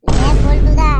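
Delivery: loud threatening call; angry shout. Acted. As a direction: yell his name as a warning. Heated mock quarrel. (রেগে জোরে ডাক)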